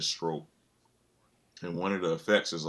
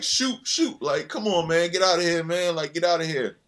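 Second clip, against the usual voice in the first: higher, loud dismissive shoo; Michael Jordan brushing off kids outside the Hornets facility; Mike shooing kids; 9 semitones higher, 4 dB louder than usual. Retold or imitated. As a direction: wave the kids off, annoyed. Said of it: "And Mike was like".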